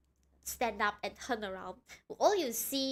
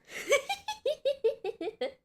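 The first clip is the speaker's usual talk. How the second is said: high laugh; 3 dB louder than usual. Real, not acted. Laughing at own joke.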